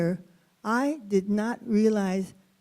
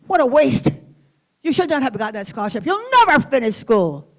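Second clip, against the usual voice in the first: louder, contemptuous teacher scolding; scornful teacher; 3 semitones higher, 6 dB louder than usual. Retold or imitated. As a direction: spit the words out in disgust. Teacher scolds her, spitting.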